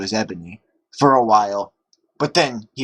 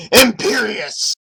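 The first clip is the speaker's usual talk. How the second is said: slow, high, loud incantation; hammed-up spell cry; spell shout; 10 semitones higher, 4 dB louder, slower than usual. A narrator's reading. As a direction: cast it loudly and slowly. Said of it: "Voldemort shouted".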